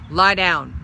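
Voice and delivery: loud, stern command; harsh order. A narrator's reading. Angry dog ordering Molly down, loud harsh command. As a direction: cut her off sharply. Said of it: "He spoke in a harsh voice".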